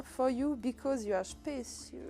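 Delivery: English read with a heavy French accent, slow; strong French accent in English. Shown as a demonstration. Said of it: "j'ai un accent anglais de ouf". (anglais avec un fort accent français, lent)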